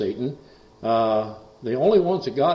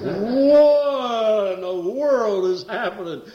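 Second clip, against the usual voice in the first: startled wife; 10 semitones higher, 5 dB louder, much slower than usual. Retold or imitated. Wife startled by possessed woman.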